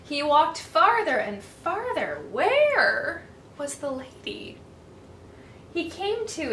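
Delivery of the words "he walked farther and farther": ordinary delivery, nothing marked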